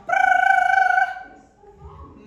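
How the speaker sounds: very high, loud ringing buzz; alarm clock ring. A narrator's reading. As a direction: blast it like an alarm going off. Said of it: "went the alarm clock".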